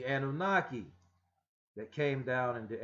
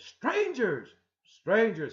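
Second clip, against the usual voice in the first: loud, much higher startled cry; 10 semitones higher, 10 dB louder, much slower than usual. Acted. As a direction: jump back in alarm. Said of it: "He was startled".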